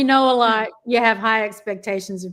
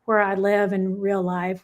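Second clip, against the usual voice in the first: ordinary delivery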